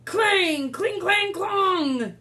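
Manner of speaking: high ringing clangs; clashing swords. Acted. Reader voicing swords waving at Boy. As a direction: ring each clang out. Battle sounds.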